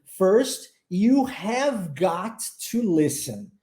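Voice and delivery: slow "have got to", every word separate; careful, uncontracted "you have got to listen"; formal full form. Shown as a demonstration. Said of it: "num inglês mais, mais culto".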